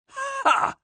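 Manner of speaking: high, sharp scoffing ha; sinister ha. Acted. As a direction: let it sound full of hidden meaning. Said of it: "with an accent that plunged".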